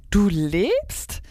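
lightly acted amazed cry; calling out. A narrator's reading. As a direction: ask it with rising disbelief. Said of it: "rief Stella Luna".

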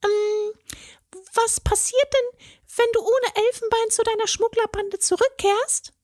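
very high fairy voice, hesitant and soothing; placating fairy. A narrator's reading. Fairy tries to calm the tearful troll, high and hesitant. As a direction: distract the troll before he cries.

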